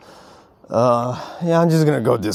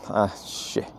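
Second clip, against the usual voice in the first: muttered curse; 4 semitones lower, 10 dB quieter than usual. Real, not acted.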